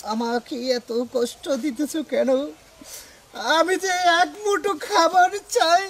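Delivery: slow, pleading starving man; stage lament. Acted. Actor rehearses hungry lament.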